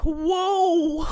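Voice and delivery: high, loud drawn-out whoa; comic alarm at a threat; mock alarmed whoa. Dean as Pete pulls out a gun, mock alarmed.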